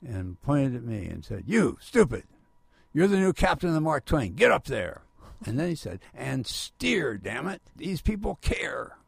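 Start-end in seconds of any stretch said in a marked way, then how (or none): 1.5-5.0 mock angry boss, loud and higher; imitating a boss's loud command; retold gruff order. Angry boss ordering him aboard. 6.1-9.0 higher, exasperated mock command; retold exasperated order. Boss's parting order, acted.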